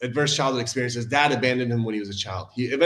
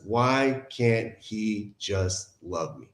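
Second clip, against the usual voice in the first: slow, softer, understated plea; hurt underneath; 3 semitones lower, 5 dB quieter, much slower than usual. Retold or imitated. Speaker revealing the wound under the joking mask, slow subdued hurt. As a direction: draw each word out slowly and quietly. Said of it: "Underneath it was".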